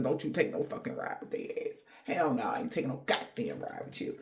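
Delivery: soft, dropped-voice aside; half-whispered no-way aside; hushed warning. Real, not acted. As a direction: lean in and warn them quietly.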